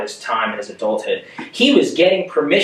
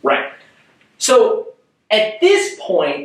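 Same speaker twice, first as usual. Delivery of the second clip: ordinary delivery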